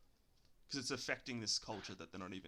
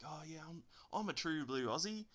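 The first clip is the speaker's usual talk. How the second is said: mock broad Aussie; 6 semitones higher than usual. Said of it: "they act like".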